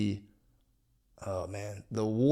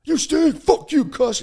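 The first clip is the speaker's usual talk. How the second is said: much higher, shouted booing; hammed-up crowd heckle; heckler jeers; 11 dB louder, much slower than usual. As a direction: boo him off the stage like a heckler. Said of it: "that nagging goddamn fear of judgment".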